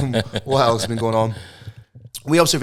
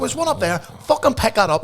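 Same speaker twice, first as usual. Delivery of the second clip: much higher, pointed command; retold order; 12 semitones higher, 4 dB louder than usual. Ordering the litterer to pick up the peel, higher mock command. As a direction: point at the bin and order him. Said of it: "I was like".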